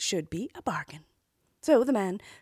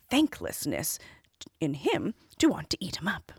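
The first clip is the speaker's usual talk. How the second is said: plain reading voice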